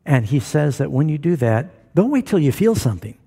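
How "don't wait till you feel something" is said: ordinary talk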